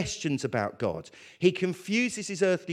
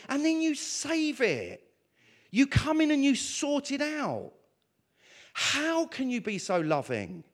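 raised, wondering prayer voice; heartfelt worship, higher and lifted; awed prayer; 7 semitones higher than usual. Acted. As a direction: pour it out to God in awe.

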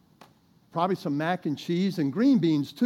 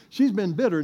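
normal talking voice

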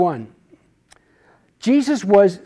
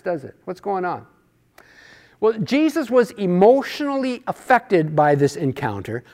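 ordinary talk, nothing marked

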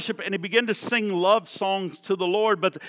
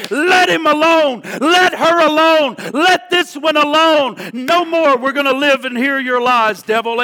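defiant rebuke; 7 semitones higher, 8 dB louder than usual. Acted.

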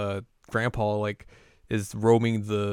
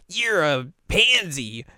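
high, loud, slow mocking taunt; mocking big sister; 7 semitones higher, 3 dB louder, slower than usual. Kagome taunting her scared little brother, high and mocking.

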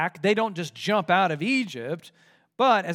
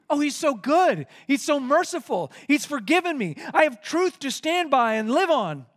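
higher, louder gushing; bursting with zeal; eager new believer; 8 semitones higher, 5 dB louder than usual. Retold or imitated. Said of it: "you're thinking".

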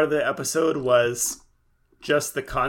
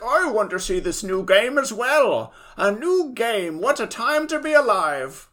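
put-on old man voice; 8 semitones higher than usual. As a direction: play a thrilled old man. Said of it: "ninety-year-old man who's like".

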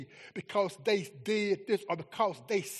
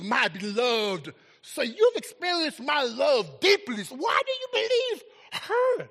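higher and louder, preaching God's rebuke; booming voice of God; 8 semitones higher, 6 dB louder than usual. Acted. God rebuking the preacher who quit, in a booming voice. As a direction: thunder as God calling his beloved back. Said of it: "and God says".

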